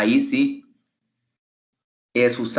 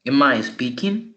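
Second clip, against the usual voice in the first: spoken slowly for the class to follow; slow, clear question; 2 semitones higher than usual. Shown as a demonstration. Teacher quizzing the class, slow and clear question. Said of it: "It's a question".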